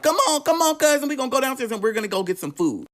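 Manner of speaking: high, fast, excited cousin urging; cousin's excited call. Retold or imitated. Cousin hurrying narrator downstairs, high and excited. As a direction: squeal it like an eager young cousin.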